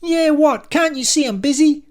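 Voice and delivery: very high, loud snap; shrieking, impatient receptionist; irritated screech. A narrator's reading. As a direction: snap at someone interrupting you. Lucy snaps when interrupted mid-scream.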